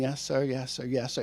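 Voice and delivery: put-on a boy mumbling obedience to his father; meek yes sir. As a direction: son answering his scolding dad, meek and soft. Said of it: "he's going".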